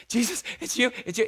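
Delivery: panting, excited swimmer; breathless, excited recognition; out of breath. Acted. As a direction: gasp the words as if just out of the water. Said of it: "out of breath and just dripping".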